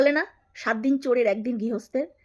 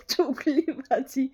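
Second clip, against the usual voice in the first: mock whisper; much slower than usual. Echoing rival's insult in whisper.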